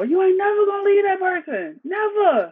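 loud, high fed-up friend voice; exasperated yell. Retold or imitated. Friend fed up with the same relationship story, exasperated yell. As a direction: throw up your hands and holler at her.

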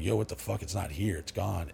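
low, stunned and hushed; quiet retold shock at finding it gone; hushed dismay. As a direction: say it under your breath, as if the stash has just vanished.

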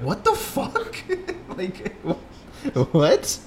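squeaky high laughing what the fuck; cracking up in stunned disbelief. Retold or imitated. Listener hears the brother back the tale, laughing disbelief. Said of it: "you'll just be like".

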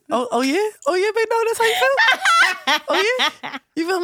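high, loud teasing with laughs; excited. Real, not acted. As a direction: tease them, laughing and loud.

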